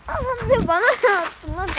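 laughing, high startled protest; surprised. Real, not acted. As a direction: yelp and complain. Something thrown at his foot.

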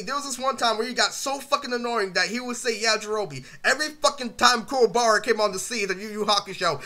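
normal talking voice

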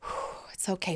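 unvoiced whisper to herself; whispered self-calming. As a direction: breathe it out as a whisper. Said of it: "I'm going".

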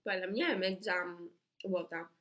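eating between words; talking while chewing. Real, not acted. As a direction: mouth partly full. Seen 2: Both hold bitten crepe pieces; speaker talks between bites, chewing.

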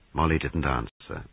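ordinary delivery

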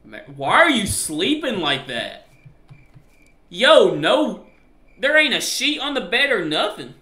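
loud, high, swooping incredulity; appalled disbelief. Real, not acted. Husband seeing wife on a bare bed, appalled disbelief. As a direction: exclaim in rising outrage, drawing words out.

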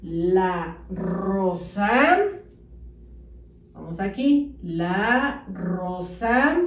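"la rosa" stretched out very slowly; very slow dictation. Shown as a demonstration. Teacher dictating slowly while children write. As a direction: drag each syllable of "la rosa". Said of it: "sobre la rayita escriben".

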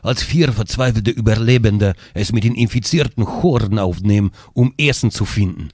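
put-on low, epic Russian accent narration; russian accent in german. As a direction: read the intro in a Russian accent. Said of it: "bitte mit russischen, ne, Akzent". (tiefer, epischer russischer Akzent)

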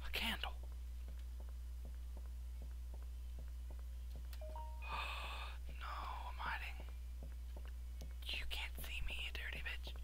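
breathless scared whisper. Real, not acted. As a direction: whisper while hiding. Hiding from monster.

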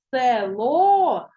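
Село stretched out, loud, landing on the final О; stress on Село's О. Shown as a demonstration. Teacher showing pupils where the stress falls, Село with a long stressed О. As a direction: draw out Село syllable by syllable, leaning on О. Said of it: "Давайте поставимо наголос".

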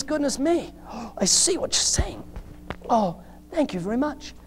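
startled realization. Acted. Passenger finally understands about the jump, acted startled realization. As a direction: gasp as the danger dawns, then thank them. Passenger grasps danger of jumping.

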